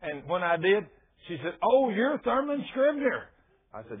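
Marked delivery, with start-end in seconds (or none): 1.6-3.3 high, drawn-out exclamation; delighted recognition. Retold or imitated. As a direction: greet a long-awaited person excitedly. Wife recognises him with delight.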